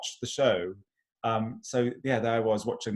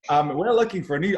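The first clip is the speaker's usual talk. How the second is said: higher, louder attempt at Aussie; poor Australian accent; 5 semitones higher, 5 dB louder than usual. Soap character announcing a search, in a poor Australian accent. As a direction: keep up the bad Australian accent. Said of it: "I don't know why I'm carrying on with the accent".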